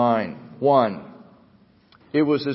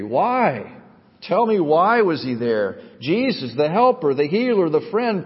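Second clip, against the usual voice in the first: higher-pitched, pleading questions; impassioned hymn recitation; 6 semitones higher, 3 dB louder than usual. Acted. Preacher recites hymn's questions to congregation, impassioned recitation. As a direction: recite the hymn with rising fervour.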